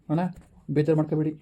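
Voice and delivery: ordinary talk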